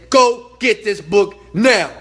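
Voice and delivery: loud, slow, punched-out order; urgent command. Retold or imitated. Speaker retelling his order to followers, urgent command. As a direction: order them to act, loud and deliberate.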